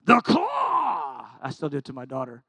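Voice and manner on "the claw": mock monster shout, high and loud; spring it on the kids; playful shout. Dad playing the claw game with his little kids, mock shout. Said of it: "I used to go".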